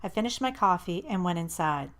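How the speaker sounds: ordinary delivery, nothing marked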